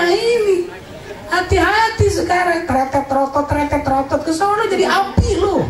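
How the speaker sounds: high, taunting scold, jeering at the sinner; jeering Betawi taunt, pitched high; mocking hell voice. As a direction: play hellfire mocking someone who owes money.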